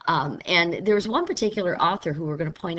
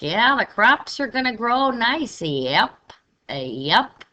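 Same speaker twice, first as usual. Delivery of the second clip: Down East Maine drawl, higher and louder; Maine accent; 4 semitones higher, 5 dB louder, slower than usual. Shown as a demonstration. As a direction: play a slow Maine farmer, yep and all. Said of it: "they might say something like".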